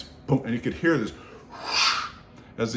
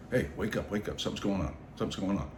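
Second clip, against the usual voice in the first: inner voice; 3 semitones lower, 8 dB quieter, faster than usual. Retold or imitated. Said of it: "little voice in my head going".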